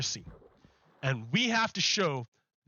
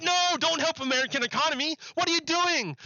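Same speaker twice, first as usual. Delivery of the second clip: lightly hammed-up indignant Republican; mock Republicans; 8 semitones higher than usual. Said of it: "Republicans like".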